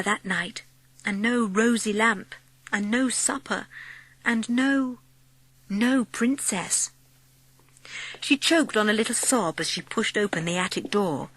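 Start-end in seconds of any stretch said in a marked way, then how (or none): none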